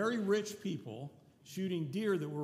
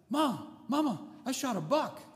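high, loud, imitating an excited grandson; excited boy; 9 semitones higher, 6 dB louder than usual. Sick grandson telling Mom and Daddy he shot a buck, imitated excited shouting. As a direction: shout the news like a thrilled kid calling his parents. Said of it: "he was all excited".

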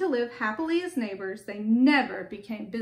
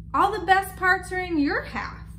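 higher-pitched storybook complaint; aggrieved bear, pitched up; protesting; 6 semitones higher, 4 dB louder than usual. A narrator's reading. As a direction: protest an unfair share. Bear complains at his pile.

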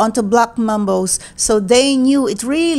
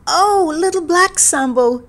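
higher-pitched motherly call; 6 semitones higher than usual. A narrator's reading. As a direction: call to the child at play. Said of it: "called him".

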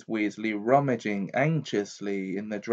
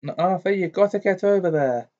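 higher, louder pressing voice; urgent wizard; 7 semitones higher, 5 dB louder, faster than usual. A narrator's reading. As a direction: urge him to hurry.

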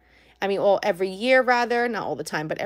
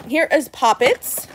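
ordinary talk

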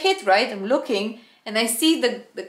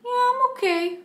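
high squeaky reply; 13 semitones higher than usual. Retold or imitated. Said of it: "he's like".

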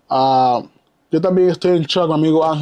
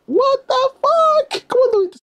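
a slow, drawn-out squeak pitched extremely high; shocked squeal; slower than usual. Real, not acted. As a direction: squeal in total disbelief at the photo. Disbelief at the wrestler's photo.

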